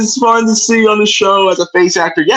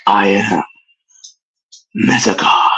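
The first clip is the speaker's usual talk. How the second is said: villain voice. Pretend. Villain impression, very low.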